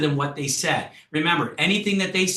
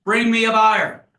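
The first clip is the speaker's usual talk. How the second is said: loud, slow, higher demand from a seller; demanding fisbo; 6 semitones higher, 6 dB louder, slower than usual. Acted. Home seller demanding the agent bring him a buyer, loud. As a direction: bark it like a stubborn home seller. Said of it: "That's the fisbo who says".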